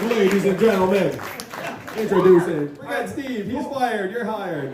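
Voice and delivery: a playful, laughing hint of a hype-man intro; hype man announcer. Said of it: "I want him to be my hype man".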